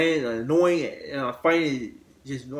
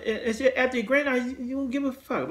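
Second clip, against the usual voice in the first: squeaky, much higher mock voice; imitating the uncle in a much higher voice; mock creepy brother; 9 semitones higher than usual. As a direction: play him high and careless, in mockery. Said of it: "Like, like".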